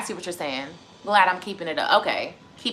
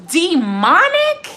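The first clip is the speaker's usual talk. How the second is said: incredulous; 4 semitones higher, 6 dB louder, much slower than usual. Real, not acted. Repeating word in disbelief.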